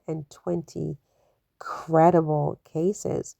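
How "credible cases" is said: credible drawn out slowly and leaned on; slow, weighty credible; stress on credible. Shown as a demonstration. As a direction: lean hard on credible, stretch it out. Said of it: "emphasis on the word credible".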